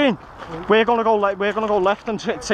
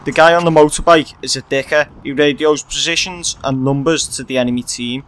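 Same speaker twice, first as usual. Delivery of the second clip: vlog narration; 7 semitones lower, 4 dB louder than usual. A narrator's reading. Voiceover explaining dicker rule.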